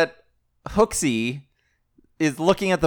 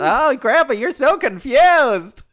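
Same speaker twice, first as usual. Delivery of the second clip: higher, slow, condescending; hammed-up patronizing grandson; mock pitying grandson; 9 semitones higher, 4 dB louder, slower than usual. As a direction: talk down like to a confused grandpa. Voicing grandson, mock pity.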